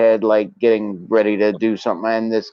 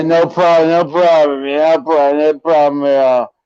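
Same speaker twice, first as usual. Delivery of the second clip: loud, high, hurried mock appeasing; meek backing down; 6 semitones higher, 5 dB louder than usual. Chastened drunk to the college kid, loud high appeasing. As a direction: back off fast like a cowed drunk.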